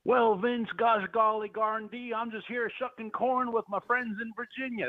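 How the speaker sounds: high-pitched, hammed-up Virginia farmer twang; Virginia farmer accent. Guest greets radio host as a corn-shucking farmer, mock Virginia accent. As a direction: put on a corny, high Virginia country accent. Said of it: "That's my new accent for your show".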